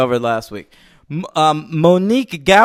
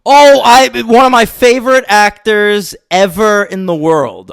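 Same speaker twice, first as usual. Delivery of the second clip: high, loud burst of fan enthusiasm; real delighted outburst; excited gushing; 6 semitones higher, 6 dB louder than usual. Real, not acted. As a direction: gush it out, starting high and loud. Gushing over a favourite actor.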